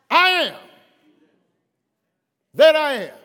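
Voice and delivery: booming preacher voice as God; thundering pulpit delivery speaking as God; preaching at full force. Acted.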